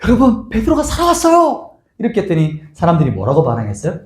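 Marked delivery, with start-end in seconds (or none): 0.0-1.7 much higher, eager announcing voice; excited girl. Retold or imitated. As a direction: blurt out the news like a child. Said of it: "뭐라고 말했어요".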